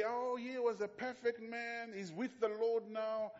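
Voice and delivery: high, slow, syrupy funeral voice; mock pastor eulogy. Pastor mocking a lying eulogy, high and slow. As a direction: put on the pious eulogy tone. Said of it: "and they say".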